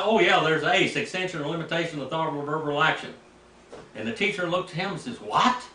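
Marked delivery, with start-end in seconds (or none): 0.0-3.1 higher, louder, quick-fire student answer; confident rattled-off answer. Retold or imitated. Student answering at the board in Greek class, rattled off confidently. 5.2-5.6 imitated incredulous teacher, higher and louder; a loud, rising question of disbelief; startled disbelief. Teacher looking at his student, startled disbelief.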